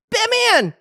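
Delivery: put-on high, loud child's cry of Batman; high mock kid yell. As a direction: shout it like the awestruck kid. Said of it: "he goes".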